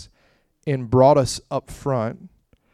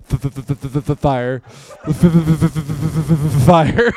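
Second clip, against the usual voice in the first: stuck on the f, stammering into fire; can't get the word out, overwhelmed; rising louder stammer to fire; 9 dB louder than usual. Retold or imitated. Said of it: "That's all I could get out".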